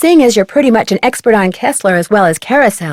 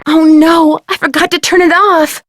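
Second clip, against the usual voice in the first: dismayed realization; 7 semitones higher, 3 dB louder than usual. Acted. [name] realizes her mistake.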